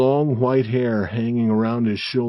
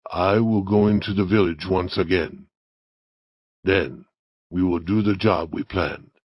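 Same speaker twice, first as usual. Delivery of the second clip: low villain's voice giving orders; commanding Indian Joe; 4 semitones lower than usual. Acted. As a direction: lay out the plan in a lowered voice. Story figure Indian Joe gives orders.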